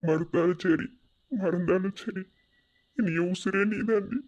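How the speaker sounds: low, soft, choked-up promise; tearful vow. Acted. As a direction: pledge devotion quietly through tears. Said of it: "அழுகாத".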